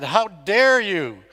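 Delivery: slow, high, loud and accusing; drawn-out yell, pitched high, at world leaders; imitated yell. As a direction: hurl it at the officials like a young activist. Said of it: "she yelled at them and said".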